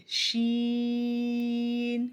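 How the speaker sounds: شین stretched three to five counts, no nasal; long drawn sheen. Shown as a demonstration. Teacher drawing out شین for pupils. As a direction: hold شین long without going through the nose. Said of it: "تین سے پانچ الف کے جتنا".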